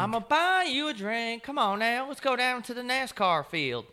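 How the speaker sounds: high, slow Southern drawl; hammy Southern good ol' boy voice. Acted. Echoes in Southern voice.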